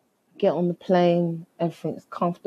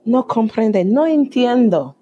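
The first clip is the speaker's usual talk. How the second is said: loud, higher mock-Spanish protest; feigned incomprehension; 5 semitones higher, 3 dB louder than usual. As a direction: play dumb, loudly and emphatically. Feigns not understanding, in Spanish.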